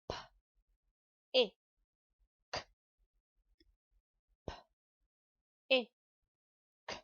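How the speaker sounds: "P, I, k" each sound said apart with pauses. Shown as a demonstration. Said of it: "Let's sound that out together now".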